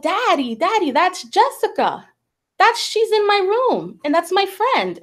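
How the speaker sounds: lightly voiced excited child. Child excitedly telling dad.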